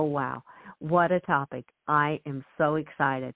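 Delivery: usual speaking voice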